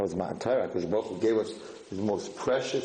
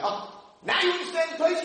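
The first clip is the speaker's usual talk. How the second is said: delighted exclaim. Retold or imitated. Study partner exclaiming in joy.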